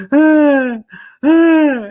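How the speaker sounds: hammed-up toddler wailing; mock drama cry. Mother imitating a child's drama cry, high and loud. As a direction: fake a whiny kid's cry. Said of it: "there's a kid going".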